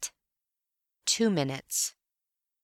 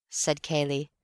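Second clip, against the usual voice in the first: plain reading voice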